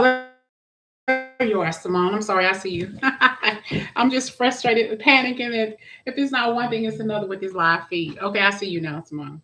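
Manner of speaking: usual speaking voice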